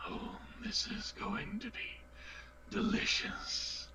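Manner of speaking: whispered, drawn-out relish; gleeful whisper. Acted. Player voices his character relishing what comes next, whispered. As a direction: savour it in a breathy whisper. Game character gloats in a hush.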